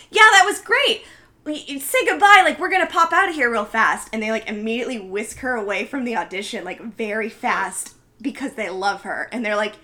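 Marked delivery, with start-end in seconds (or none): 0.1-4.1 high, loud, eager imitation; retold gushing excitement. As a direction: act out gushing casting directors hurrying an actress away. Casting directors hurriedly praising Betty.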